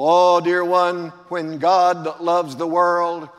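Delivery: usual speaking voice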